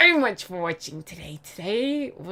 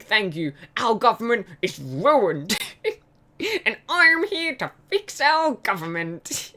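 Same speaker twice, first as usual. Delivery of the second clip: higher, slow politician speech; mock politician; 4 semitones higher, 4 dB louder, slower than usual. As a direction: parody a pompous opposition leader. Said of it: "the opposition leader response to that".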